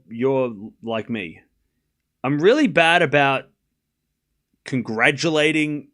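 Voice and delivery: ordinary delivery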